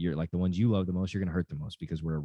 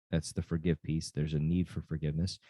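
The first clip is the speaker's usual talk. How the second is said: ordinary talk, nothing marked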